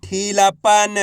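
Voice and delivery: ढीलापन drawn out, every syllable held; syllables blended slowly. Shown as a demonstration. Teacher reading for students to repeat, ढीलापन drawn out. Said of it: "उच्चारण करके इनको पढ़ रहा हूँ".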